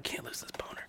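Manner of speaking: hushed whisper, breath only. Retold or imitated. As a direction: whisper so nobody hears. Whispering while hiding in trench.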